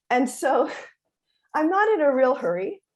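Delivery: ordinary talk, nothing marked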